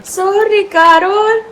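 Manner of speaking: high, sweet apology to a pet. Real, not acted. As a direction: coo an apology at the dog. Apologizing to the dog.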